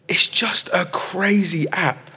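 slow whispered aside; whispering. Real, not acted. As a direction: whisper it like a secret. Confiding about the TikTok app.

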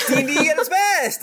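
hype-man declaration; excited proclaim. Acted. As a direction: sell it like an ad. Reading a review with gusto.

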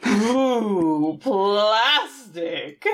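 drawn-out, wonderstruck mock delight; hammed-up sincere awe; genuine awe. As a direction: savour it slowly in imitated amazement. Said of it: "He's one hundred percent genuine".

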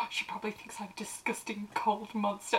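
gasp, then a panicked whisper. Retold or imitated. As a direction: hiss the worry under the breath like a fretting teen vampire. Mocking Edward's panicked inner voice.

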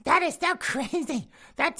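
hammed-up flamboyant gasp of disbelief; campy queen impression. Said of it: "the queen's out there going".

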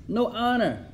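ordinary talk

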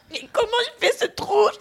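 high, laughing disbelief; baffled exclamation. Retold or imitated. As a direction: ask it in total bafflement. Retold baffled outburst, laughing.

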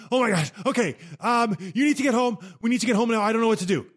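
high-pitched panicked rush; panicked wife calling again about the injury; frantic. Retold or imitated. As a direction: voice the wife losing it with worry. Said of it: "who is absolutely frantic".

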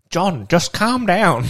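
calm her husband down, slowly; imitating the lady. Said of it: "she's like".

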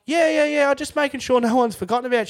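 loud, high, quick retold reply; calling back. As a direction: rattle it off loudly to a busy nurse. Calling back to the nurse, acted.

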